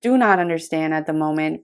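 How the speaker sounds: ordinary delivery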